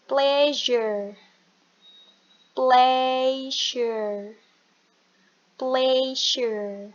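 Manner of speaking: "pleasure" drawn out as PLEH-zhur; pronunciation demo. Shown as a demonstration. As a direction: model "pleasure" slowly for learners. Teacher models pronunciation slowly. (contoh pelafalan "pleasure" yang dipanjangkan)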